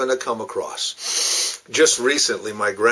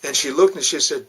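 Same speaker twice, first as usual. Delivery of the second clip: ordinary talk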